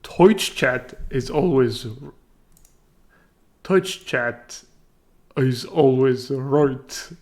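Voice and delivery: slow Irish practice line, "Twitch" as toitch; Irish accent. Shown as a demonstration. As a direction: sound out the Irish oy, slowly. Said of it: "Practice sentence".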